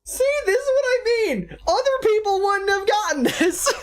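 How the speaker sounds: very high squealed exclamation; sky-high, near-crying with laughter; cracking-up protest. Real, not acted. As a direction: squeal the point out, overcome with amusement. Protesting while cracking up at option.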